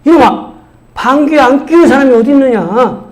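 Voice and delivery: high-pitched indignant scolding; retold outraged rebuke; angry father. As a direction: imitate a father telling off a cheeky boy. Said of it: "아버지가 또 화내는 거죠".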